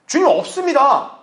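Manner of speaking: high, slow, emphatic reply; immediate answer. Shown as a demonstration. Believer answering the Lord at once, high emphatic reply. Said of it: "즉각적으로 대답을 해야죠".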